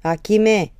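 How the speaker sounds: loud call across the yard; father calling his son back from the porch, loud. Acted. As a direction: shout the name to the son in the road. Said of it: "omul strigă de pe prispă".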